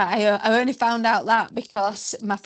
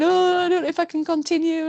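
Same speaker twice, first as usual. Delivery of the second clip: high, whiny mock despair; mock blubbering; 8 semitones higher, faster than usual. Tired runner at a checkpoint, mock blubbering. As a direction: play the sobbing runner, high and pitiful. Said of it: "blubbering away, saying".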